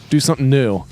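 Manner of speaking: ordinary delivery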